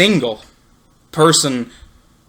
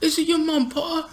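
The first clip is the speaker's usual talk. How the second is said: "Is it your mom, Potter?" said high and soft, sneering; high mock taunt; 14 semitones higher, 8 dB quieter than usual. Friend redoes the taunt as coached, high and soft. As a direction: squeak out the taunt. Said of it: "There you go".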